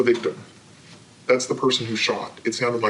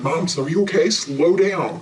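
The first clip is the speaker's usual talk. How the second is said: daughter calming her; 6 semitones higher, 4 dB louder than usual. Retold or imitated. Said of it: "she said".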